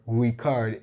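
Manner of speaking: record with a short ri and stress on CORD, /rɪˈkɔrd/; record as in to tape it, re-CORD; verb record. Shown as a demonstration. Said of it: "is using it as a verb".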